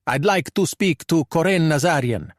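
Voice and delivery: put-on Italian-accented English; pronounced Italian accent. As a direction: speak like a Calabrian thug trying his English. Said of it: "in a pronounced Italian accent".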